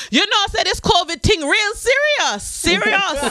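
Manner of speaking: high, earnest Caribbean aunt; aunt lecturing on covid; aunt's voice. Retold or imitated. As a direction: preach about covid like an older aunt. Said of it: "Do not care".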